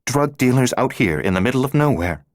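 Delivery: scornful scoff. A narrator's reading. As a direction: sneer at the idea. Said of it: "she said scornfully".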